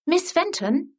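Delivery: put-on high, bright young girl calling her teacher; eager schoolgirl. A narrator's reading. Schoolgirl calling for her teacher's attention, high and eager. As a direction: call up to miss as a keen pupil.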